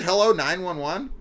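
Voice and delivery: high, loud questioning hello; mocking a caller. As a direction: replay the awkward movie phone line. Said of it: "What was it?".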